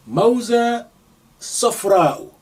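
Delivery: Mauza safra'u, each syllable spelled out carefully; teacher modelling Mauza safra'u; Arabic word said slowly. Shown as a demonstration. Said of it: "You can say".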